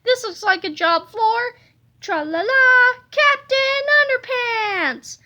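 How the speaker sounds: high, slow, triumphant superhero call; heroic catchphrase. A narrator's reading. Narrator proclaiming the hero's arrival, high and triumphant. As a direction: belt it out like a cartoon hero's fanfare.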